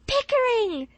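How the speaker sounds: very high, loud shriek of joy; Polly screaming the winner's name; joyful scream. A narrator's reading. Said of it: "screamed Polly".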